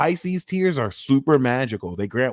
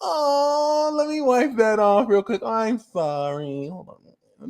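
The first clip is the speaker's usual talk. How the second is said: slick fake sympathy; 5 semitones higher than usual. Host pretending to wipe a crier's tears, fake tender coo. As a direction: coo a sly, fake apology. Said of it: "you could just be mad slick".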